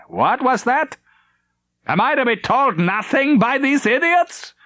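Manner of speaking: high, slow strained bark of fury; a livid admiral demanding answers; angry admiral. Acted. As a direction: admiral storms onto the bridge, high and slow. Admiral raging.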